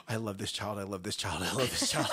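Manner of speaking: low, muttered mantra to keep calm; strained self-soothing. Acted. Father to himself as baby yells, low self-soothing chant. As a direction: talk yourself down under your breath.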